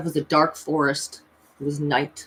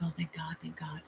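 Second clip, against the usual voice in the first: very soft, relieved murmur; hushed relief; 17 dB quieter than usual. Retold or imitated. Thanking God that music has feeling again, hushed relief. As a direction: breathe out thanks very quietly.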